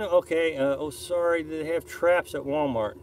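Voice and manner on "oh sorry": ordinary talk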